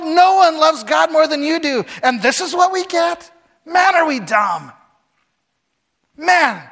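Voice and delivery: high, loud exasperated outburst; a fed-up wife shouting at her husband; frustrated wife. Retold or imitated. Wife yelling at Job in frustration, high and loud.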